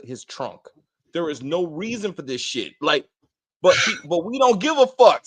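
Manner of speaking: usual speaking voice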